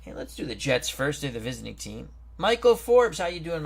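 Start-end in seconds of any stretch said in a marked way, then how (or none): 2.4-3.6 loud, high call of a friend's name; excited greeting. Real, not acted. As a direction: call out to him as he joins. Greeting a guest joining the stream.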